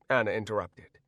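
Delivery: ordinary delivery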